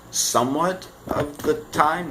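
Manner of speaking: ordinary talk, nothing marked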